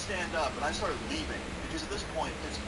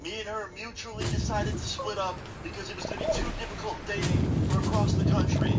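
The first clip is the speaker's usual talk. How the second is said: louder and louder until he is yelling; retold angry shout; 6 semitones higher, 8 dB louder than usual. Telling the girlfriend's mom to step back, ending in a loud shout. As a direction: build from firm to a full shout.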